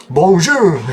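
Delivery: a hammed-up French "Bonjour!", pitched high with a laugh; mock French accent. Teasing viewers about how French people sound, in a mock French accent. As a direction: toss off a jokey French greeting, laughing. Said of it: "until you hear the accent".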